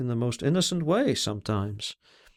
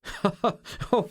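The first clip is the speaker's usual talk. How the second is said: short, high scornful laugh; 8 semitones higher than usual. A narrator's reading. He scoffs at his own thought, scornful laugh. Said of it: "He laughed scornfully".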